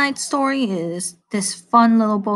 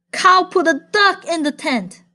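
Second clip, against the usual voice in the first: higher, confident frog voice; garbled frog message; 7 semitones higher than usual. Acted. Frog misreporting to pig, higher earnest voice. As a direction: say the wrong message proudly.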